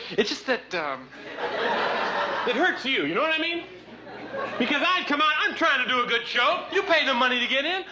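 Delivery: rising whiny complaint, pitched high; hammed-up hurt rant, climbing to a shout; mock outrage. Comic complaining to the audience about the crew, mock outrage.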